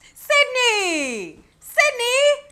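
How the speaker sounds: high, loud shouted name; imitating reporters shouting; calling out. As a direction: yell her name across a crowd.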